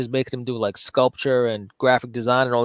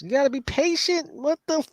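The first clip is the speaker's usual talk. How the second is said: mock preachy; 13 semitones higher than usual. Mocking advice to be patient.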